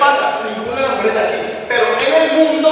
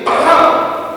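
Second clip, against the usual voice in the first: loud, sudden "pam"; punchy spoken sound effect; imitated impact noise; 5 semitones higher, 5 dB louder than usual. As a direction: voice a hard smack landing. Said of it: "Como sonó".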